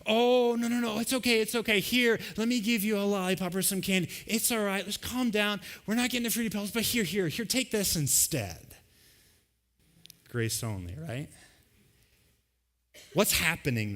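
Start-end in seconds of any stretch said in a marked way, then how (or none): 0.0-8.7 higher, sing-song coaxing parent voice; soothing pushover parent. Retold or imitated. Grace-only parent calming the child in the store, high and coaxing. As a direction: hush the child and hand over candy, much higher. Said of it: "What does grace-only parenting look like?".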